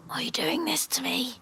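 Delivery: soft whispered plea; exasperated whisper. Real, not acted. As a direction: whisper it, worn out by the lamb. Pleading with a stubborn lamb.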